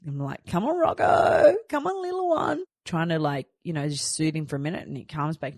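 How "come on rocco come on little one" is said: put-on soothing baby talk. Mum soothing crying baby Rocco, high coaxing coo. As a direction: coo to settle a crying infant.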